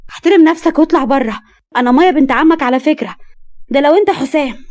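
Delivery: loud, high furious command; girl ordering her drunk cousin out, loud and angry. Acted. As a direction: throw him out of the room.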